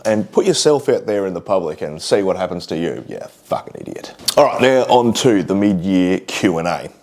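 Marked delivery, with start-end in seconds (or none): 3.1-4.1 unvoiced, dismissive mutter under the breath; muttered insult. Real, not acted. Vlogger brushing off rude commenters, muttered insult. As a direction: drop to a near whisper and mutter it away.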